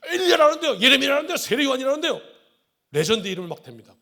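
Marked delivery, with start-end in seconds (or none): 0.1-2.2 much higher, louder clamoring; thrilled rapid listing; excited. Retold or imitated. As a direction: disciples tumbling over each other. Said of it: "흥분하고 있습니다".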